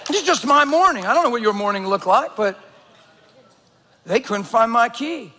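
ordinary talk

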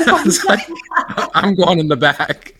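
high, laughing through the words. Real, not acted. Storyteller retells slipping away to the back, laughing. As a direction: tell it while breaking into laughter.

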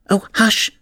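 high, hushed warning; small high-pitched anxious hush; frightened whisper. A narrator's reading. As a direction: shush them fearfully. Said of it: "whispered in a frightened tone".

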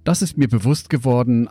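ordinary talk, nothing marked

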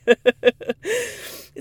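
high loud burst of laughter; big laugh. Real, not acted. Laughing about her own two dogs, high loud laugh. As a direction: laugh freely.

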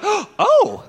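very high, loud oh of realization; sudden dawning recognition; startled high oh; surprised oh. Retold or imitated. Hairdresser recognizes him.

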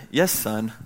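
dad answering his son quietly, a little stumped; quiet resigned answer. Retold or imitated. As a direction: concede it gently after a pause. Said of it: "I stopped and I said".

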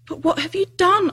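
put-on high, concerned girl's question; lightly voiced anxious girl; puzzled Lucy. A narrator's reading.

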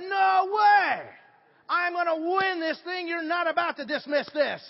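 loud, high, furious protest; outraged driver shouting at the judge; enraged shout. Retold or imitated. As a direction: yell it like a man cheated of his win. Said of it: "He said he was enraged".

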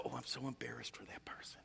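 whispered aside; hushed embarrassed whisper. Retold or imitated. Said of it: "you just go".

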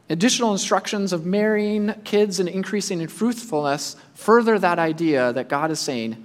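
usual speaking voice

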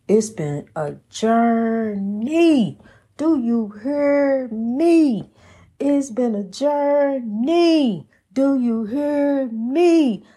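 drawn-out, sing-song preacher cadence; preaching. Real, not acted. Preacher crying out to the congregation about the journey, exultant preaching. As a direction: stretch each phrase out, rising high then falling away.

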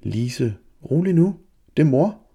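calm her down from above; mother calming her panicked daughter; reassuring mother. A narrator's reading. Mother calming a screaming child.

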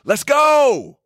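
loud, very high rallying shout; rousing yell. Shown as a demonstration. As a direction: yell to wake up a sluggish gym. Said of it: "by simply yelling".

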